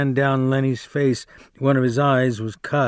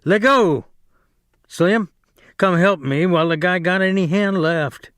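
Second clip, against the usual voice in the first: shouting; 6 semitones higher than usual. Acted. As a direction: shout again and again to break through. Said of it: "George shouted over and over".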